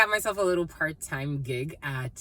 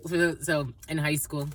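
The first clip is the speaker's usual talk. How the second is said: ordinary talk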